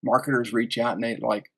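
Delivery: normal talking voice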